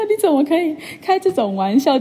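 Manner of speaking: high, incredulous inner protest; can you believe this, said up high; indignant thought. Retold or imitated. Nervous patient protesting the doctor's egg joke, incredulous.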